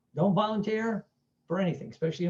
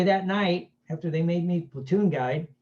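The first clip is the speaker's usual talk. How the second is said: usual speaking voice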